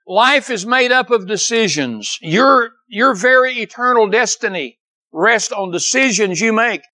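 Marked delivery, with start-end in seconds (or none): none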